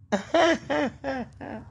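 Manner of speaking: high, breathy burst of laughter at herself. Real, not acted. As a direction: laugh off the slip, high and bright. Laughs at own German slip.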